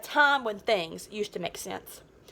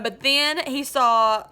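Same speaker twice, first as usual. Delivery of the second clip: ordinary delivery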